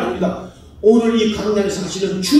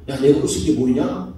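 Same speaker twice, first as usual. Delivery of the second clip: God's challenge; 7 semitones lower than usual. Acted. Preacher voices God being slighted, lightly acted low challenge. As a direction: lower the pitch for a contained divine challenge. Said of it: "하나님이 이 일을 당하고 있다는".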